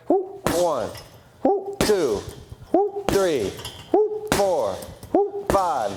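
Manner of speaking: rhythmic counting with whoops; coach counting reps. Shown as a demonstration. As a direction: call each rep.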